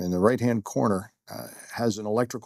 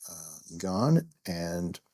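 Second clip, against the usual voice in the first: usual speaking voice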